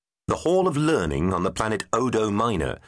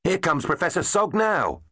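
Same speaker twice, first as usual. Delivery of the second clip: higher, eager announcement; excited; 10 semitones higher than usual. A narrator's reading. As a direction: point him out with glee. Tegs cheering Sog's arrival.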